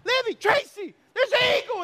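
high, thrilled family alert; excited, high-pitched holler to the family; excited calling out. Retold or imitated. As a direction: call out a thrilling sighting.

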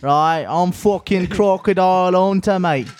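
slow, hammed-up Australian accent. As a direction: do a broad mock Aussie, drawn out. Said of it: "Every time I think about Australia".

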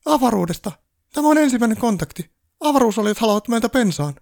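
very high, loud squeal of panic; panicked elf shriek. A narrator's reading. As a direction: shriek it like a frantic little elf. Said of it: "Paniikkitonttu kiljui".

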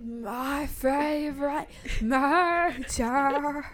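slow, drawn-out podcast intro; podcast host voice. Retold or imitated. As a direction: stretch it like a true crime host. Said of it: "That's what that voice sounds like".

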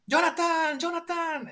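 high, panicked call for Jonathan. Retold or imitated. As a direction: yell for the husband in alarm, pitched high. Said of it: "grita por Jonathan". (grito alto y desesperado llamando a Jonathan)

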